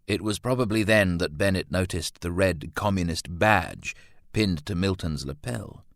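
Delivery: ordinary delivery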